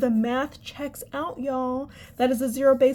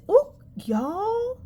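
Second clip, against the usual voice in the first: surprised; 13 semitones higher than usual. Real, not acted. Sudden realization, high pitched.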